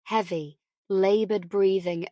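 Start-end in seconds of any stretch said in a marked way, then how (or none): none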